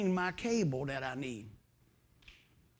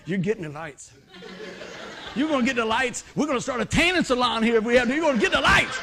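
louder, laughing insistence; insistent and loud; 5 dB louder than usual. Retold or imitated. Son insisting his mother gets the lights, loud and laughing. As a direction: overrule her with a laugh.

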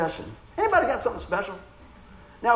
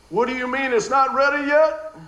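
mock-accusing demand, a bit louder; 6 semitones higher, 5 dB louder than usual. Wife hearing her husband's supper question as a mock-accusing demand. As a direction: play how the wife hears his question, accusing.

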